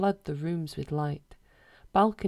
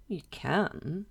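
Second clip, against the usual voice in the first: cautious. A narrator's reading. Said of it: "he says cautiously".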